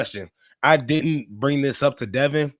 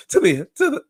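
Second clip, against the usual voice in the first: cracking on a stalled intro; 11 semitones higher, 5 dB louder than usual. Real, not acted. Host losing his voice mid-intro, cracking high. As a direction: voice breaks upward, much higher. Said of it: "have a voice crack".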